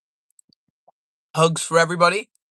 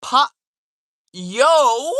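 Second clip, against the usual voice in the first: high, loud hype yo; 6 semitones higher, 5 dB louder than usual. Real, not acted. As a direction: shout it to the chat. Hype call to chat.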